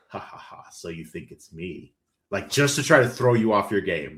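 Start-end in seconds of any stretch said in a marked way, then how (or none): 0.1-1.9 taunting cadaver. Retold or imitated. Cadaver messing with the player's head, hushed sinister taunt. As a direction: play the undead taunt low and whispery. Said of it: "cadaver'll say something like".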